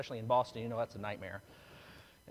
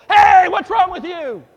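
much higher, much louder angry shout; mock road rage yell; 14 semitones higher, 17 dB louder than usual. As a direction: yell at a driver who cut you off. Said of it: "Why are you yelling?".

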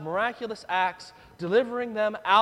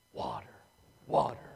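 parched moan; 9 semitones lower, 6 dB quieter, faster than usual. Shown as a demonstration. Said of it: "Say that with me".

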